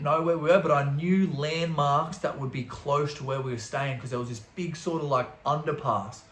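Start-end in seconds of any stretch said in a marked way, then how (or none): none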